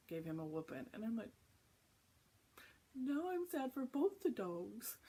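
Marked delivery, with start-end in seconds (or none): 2.9-5.0 slow, high-pitched pitying whine; mock sadness. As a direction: draw it out in exaggerated sympathy. Acts out sadness for dogs.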